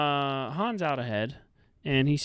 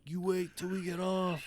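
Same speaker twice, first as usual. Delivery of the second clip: high, put-on taunting call; mock calling out; 6 semitones higher, 3 dB quieter than usual. Acts driver calling across the ferry.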